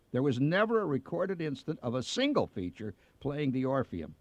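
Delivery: usual speaking voice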